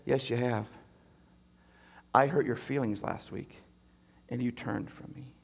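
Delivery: low and soft, slowing down; a gentle father, tenderly rebuking; quiet divine rebuke, low and soft; soft God voice. Retold or imitated.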